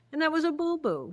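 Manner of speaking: ordinary talk, nothing marked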